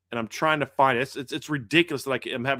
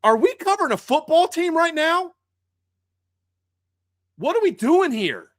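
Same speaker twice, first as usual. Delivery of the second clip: mock disbelief; 12 semitones higher, 5 dB louder than usual. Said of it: "I'm like".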